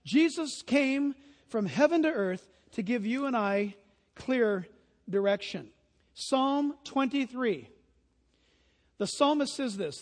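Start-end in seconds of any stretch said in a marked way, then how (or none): none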